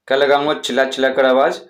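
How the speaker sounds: usual speaking voice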